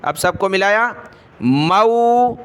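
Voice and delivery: measured sing-song letter-by-letter recitation; slow classroom recitation drill; Qaida spelling drill. Shown as a demonstration. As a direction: chant each syllable, then blend and stretch the word.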